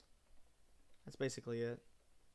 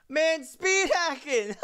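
loud, high, drawn-out yell breaking into laughter; excited shout; 14 semitones higher, 11 dB louder, slower than usual. Real, not acted. Gamer spots a speed hacker in the match, excited shout.

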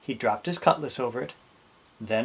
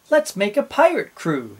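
put-on higher, louder young Jim; eager boy; 8 semitones higher, 5 dB louder than usual. A narrator's reading. Jim to his mother while baking, eager. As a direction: propose it brightly, pitched up.

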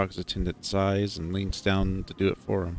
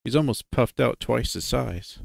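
ordinary delivery, nothing marked

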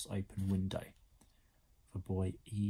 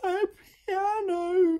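very high, loud wail; screechy-high dismayed outcry; anguished cry; 9 dB louder, much slower than usual. A narrator's reading. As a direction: wail it in horror. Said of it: "she cried from inside the mess".

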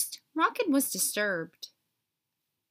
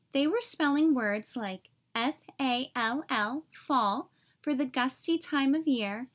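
ordinary delivery

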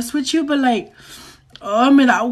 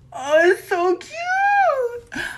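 slow, drawn-out, much higher gushing "cute"; gushing high squeal; 10 semitones higher, much slower than usual. Acted. Learning her friend has a boyfriend, she gushes, high and drawn out.

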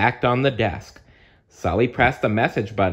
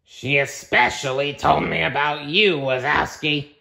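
higher, bossy monster voice; strict monster manager voice; 7 semitones higher than usual. Acted. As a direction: announce it like a stern new boss. Floz character voice.

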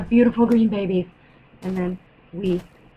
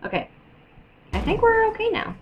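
ordinary talk

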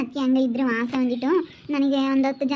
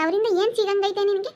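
high scolding, ಏನ್ rising in challenge; high, rising ಏನ್ in a scolding question; angry scolding; 6 semitones higher than usual. Acted. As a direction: demand what ಏನ್ ಸಿಗಂಗೈತೆ gains her, pitch high. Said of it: "ಯಾಕೆ ಬೈತೀರಾ?".

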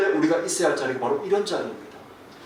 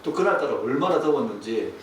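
ordinary talk, nothing marked

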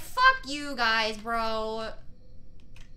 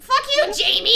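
high, loud insult; angry yell; 10 semitones higher, 9 dB louder than usual. Real, not acted. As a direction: yell it straight at Jamie. Yelling at Jamie.

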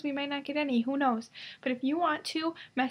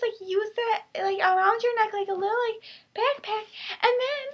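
higher, louder, hammed-up infomercial pitch; cheesy salesperson voice; 7 semitones higher, 5 dB louder than usual. Pitching her sweatpants to viewers as a hammed-up infomercial host. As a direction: sell the sweatpants like a peppy TV host. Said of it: "I don't know why I'm talking in this voice".

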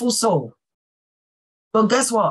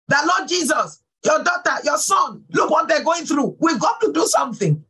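higher, shouted plea to the Lord; pleading prayer cry; 5 semitones higher than usual. Retold or imitated. Preacher voicing her prayer for a crying caller, shouting high. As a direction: cry out to Jesus for his children.

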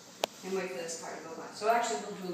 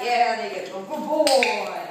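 loud dog praise; 13 dB louder, slower than usual. Real, not acted.

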